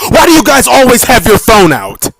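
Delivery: ordinary talk, nothing marked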